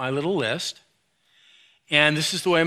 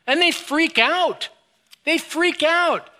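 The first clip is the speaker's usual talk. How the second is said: much higher, slow mock wail; mock kid freak-out; 11 semitones higher, slower than usual. Mimicking kids freaking out.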